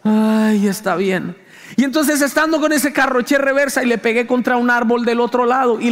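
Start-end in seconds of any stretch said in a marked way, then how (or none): 0.0-1.4 a hint of a reluctant father, lower; grudging, resigned "ay, está bien"; imitating dad. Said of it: "Y me dijo como que". (padre resignado a regañadientes)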